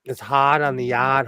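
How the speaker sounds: tentative Boston accent, dropped R's. Shown as a demonstration. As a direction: try out a Boston accent on request. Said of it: "do your Boston accent".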